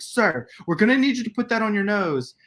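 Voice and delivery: high-pitched officious urgency; fussy, agitated mimicry up high; mock excited clerk. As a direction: imitate a worked-up store worker giving orders. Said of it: "got so excited about it".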